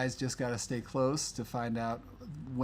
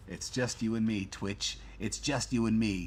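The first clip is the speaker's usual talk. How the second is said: low, put-on Chicago accent; old gangster-movie Chicago accent, pitched down; Untouchables accent; 4 semitones lower than usual. Host to the Twitch viewers left, in a mock Untouchables accent. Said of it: "that was your Chicago accent".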